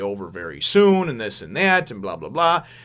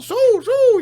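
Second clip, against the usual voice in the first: squeaky little fox cry, very high and loud; high-pitched cartoon fox calling; high squeak; 9 dB louder than usual. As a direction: squeal the name like a tiny animal. Fox call again.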